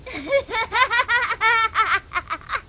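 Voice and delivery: high jeering laugh at someone; mocking laugh. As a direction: laugh down at the victim. Bully laughing at the victim.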